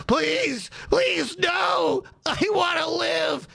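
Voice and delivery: high, begging shout for mercy; mock pleading goblin. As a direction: cry out for your life, hammed-up. Voicing goblin being shot.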